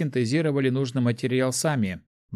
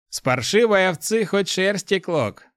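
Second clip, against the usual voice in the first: cheeks stuffed full; 8 semitones higher, 3 dB louder than usual. Acted. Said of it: "Набив рот, пробубнил Иван".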